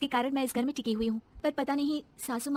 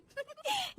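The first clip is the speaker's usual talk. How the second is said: gleeful giggle; 4 dB quieter than usual. Acted. Bride's evil glee at mirror.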